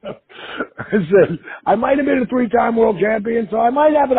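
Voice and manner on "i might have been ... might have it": mock boast. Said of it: "I'm just busting his chops".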